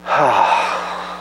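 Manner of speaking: long sorrowful sigh; a grieving sigh; heavy sigh. Shown as a demonstration. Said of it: "it means to sigh".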